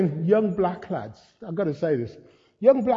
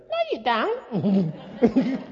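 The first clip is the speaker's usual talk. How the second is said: family calling out the preacher, drawn-out higher mimic; heckling family; 4 semitones higher, much slower than usual. Wife and daughter contradict his boast, mock heckle. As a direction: mimic a relative's slow contradiction with a laugh. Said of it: "my wife over there would say".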